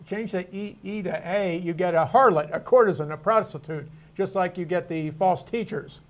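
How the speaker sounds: ordinary delivery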